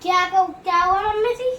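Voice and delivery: high, loud innocent question; feigned innocence. Acted. Daughter-in-law pretending not to know to her mother-in-law. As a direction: play innocent, pitch up.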